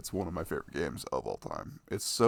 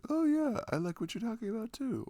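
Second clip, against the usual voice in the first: much higher, bright mock-eager reply; squeaky, much higher happy quote; eager agreeing friend. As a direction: play the friend who is glad to hear it. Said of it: "to be like".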